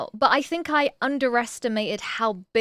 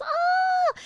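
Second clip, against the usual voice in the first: excited squeal. Real, not acted.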